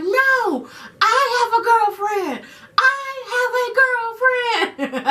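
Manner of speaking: high, laughing declaration; excited blurting. Real, not acted. Coming out to mom from the bathroom, high excited laughing. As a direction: burst out with it, half laughing.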